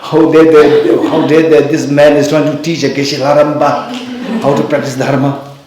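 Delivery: how dare he, hammed-up indignation; retold angry outburst. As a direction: replay the friend's outrage at being lectured. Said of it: "My friend was so angry".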